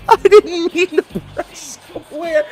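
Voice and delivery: high, slow, laughing whine; squealing laugh. Real, not acted. Gamer laughing at pressing the wrong button, high squeal.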